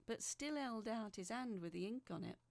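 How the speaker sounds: ordinary delivery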